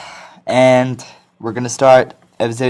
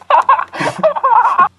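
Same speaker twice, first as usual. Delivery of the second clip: high-pitched burst of laughter. Real, not acted. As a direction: crack up laughing after the song. Laughs after singing.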